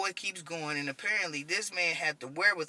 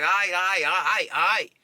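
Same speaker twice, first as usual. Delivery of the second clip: urgent placating; 3 semitones higher, 8 dB louder, slower than usual. Retold or imitated. Calming the panicked wounded man.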